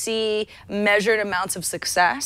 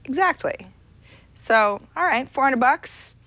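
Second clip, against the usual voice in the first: ordinary delivery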